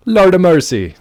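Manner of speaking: put-on high, loud exclamation in a lilting Irish-sounding accent; accidental Irish lilt. Quoting the delivery guy, accidental Irish lilt. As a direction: cry out to the heavens in a mock accent. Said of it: "Did the Irish say that?".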